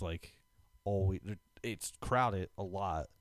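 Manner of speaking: normal talking voice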